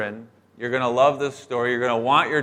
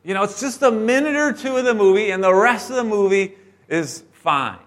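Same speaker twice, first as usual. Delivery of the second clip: put-on higher, pleading girls nagging their dad; begging daughters; 6 semitones higher than usual. Preteen girls begging dad to see the movie, pleading. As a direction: wheedle like a preteen who wants the movie. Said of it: "they begged their dad".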